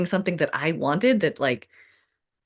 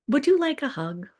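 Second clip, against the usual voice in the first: put-on gentle teen son; 8 semitones higher than usual. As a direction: offer the hug tenderly. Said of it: "and he's like".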